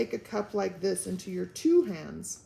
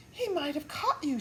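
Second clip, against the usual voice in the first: stifled shudder; 6 semitones higher than usual. Acted. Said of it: "shuddered Homily in a stiff, stifled voice".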